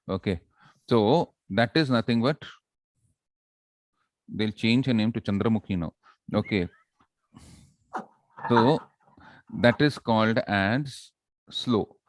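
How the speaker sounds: usual speaking voice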